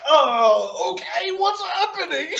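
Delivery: high, laughing, baffled; laughing bewilderment. Retold or imitated. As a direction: squeak it out like you're lost and amused. Retelling his confusion in the ring.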